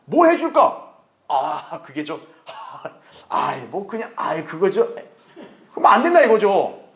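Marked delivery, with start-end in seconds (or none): 0.1-0.9 raised-pitch, lightly acted offer; the Lord offering. The Lord offers to help believer, lightly acted higher voice. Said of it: "주님 물으시는 거예요". 1.3-4.9 softer, lower hemming and hawing; mock hesitation. Believer dodging the Lord's question, mock awkward hesitation.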